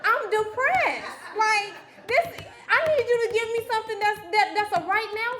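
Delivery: thinking what, I'm depressed, in the pastor's office; inner exasperation. Retold or imitated. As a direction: mock-desperate thoughts. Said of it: "in my head".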